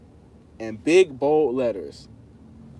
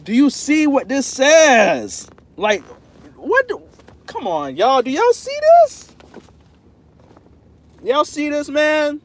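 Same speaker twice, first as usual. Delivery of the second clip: high, loud, hammed-up outrage; playful mock outrage; 10 semitones higher, 9 dB louder, slower than usual. To chat doubting the friendship, loud playful mock outrage. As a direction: rise up loud and high as if fed up. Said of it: "I love to talk like this".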